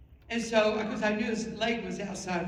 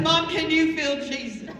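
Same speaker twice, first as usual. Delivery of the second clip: raised, urgent mock-hysterical plea; panicked hollering; 6 semitones higher, 4 dB louder than usual. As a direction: cry out to mom in re-enacted panic. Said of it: "We're screaming and hollering".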